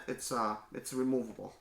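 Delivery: normal talking voice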